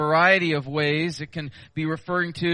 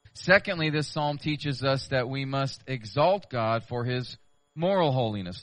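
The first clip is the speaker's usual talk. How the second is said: ordinary talk, nothing marked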